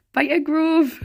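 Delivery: a go at Geordie; Geordie accent. Shown as a demonstration. Streamer channelling an old TV show, Geordie accent. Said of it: "trying to do a Geordie accent".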